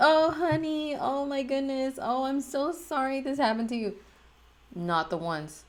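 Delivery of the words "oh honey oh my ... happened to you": much higher, cooing friend who consoles; gushing sympathetic friend. Retold or imitated. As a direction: coo it like a friend patting your back. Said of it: "pat me on the head ... and be like".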